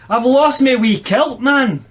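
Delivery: high, hammed-up Scottish wail; Scottish accent. A narrator's reading. Nooch upset over his lost kilt, Scottish accent. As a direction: exclaim in broad comic Scottish. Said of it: "your best Scottish accent in this one".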